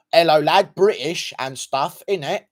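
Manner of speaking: loud, hammed-up British greeting; mock British accent. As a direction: put on a silly Brit voice. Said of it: "Sorry about my British accent".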